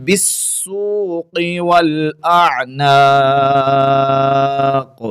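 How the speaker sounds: slow, drawn-out melodic tilawat; Quran recitation. Acted. Recites the verse. (ترتیل کے ساتھ تلاوت)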